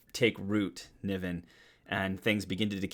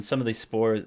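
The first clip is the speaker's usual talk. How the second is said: ordinary talk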